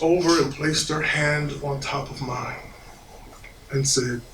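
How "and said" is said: ordinary talk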